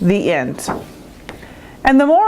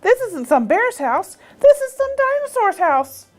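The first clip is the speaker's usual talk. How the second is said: dawning realization; 12 semitones higher than usual. A narrator's reading. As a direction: pitch climbing sharply into alarm. Goldilocks realizes whose house it is.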